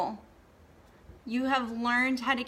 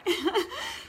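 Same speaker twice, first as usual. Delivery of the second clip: teary laugh; 11 semitones higher than usual. Real, not acted. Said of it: "didn't mean to cry".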